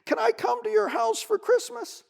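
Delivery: small high voice calling out loudly; a little bunny calling after friends; high pleading call. Acted. Bunny calls out to his friends, high and pleading.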